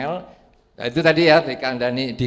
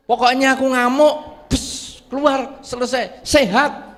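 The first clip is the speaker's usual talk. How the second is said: mock rant; 8 semitones higher than usual. Preacher acting out an angry man.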